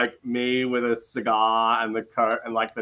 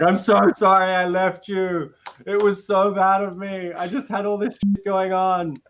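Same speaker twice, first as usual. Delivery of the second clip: higher, whiny, hammed-up sobbing apology; mock kid bawling; 9 semitones higher than usual. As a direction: blubber it out like a guilty child, half laughing. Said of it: "bawl like a kid".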